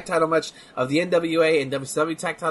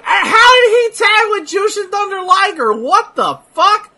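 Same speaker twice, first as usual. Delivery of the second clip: high incredulous yell; shocked outburst; 9 dB louder than usual. Real, not acted. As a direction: shout it in disbelief. Shock at tag team pairing.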